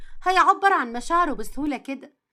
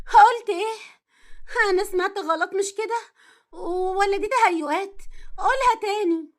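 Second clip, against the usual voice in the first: stunned disbelief; 5 semitones higher, 3 dB louder than usual. Acted. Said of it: "اتجمدت مكانها".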